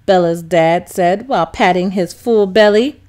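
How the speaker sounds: ordinary delivery, nothing marked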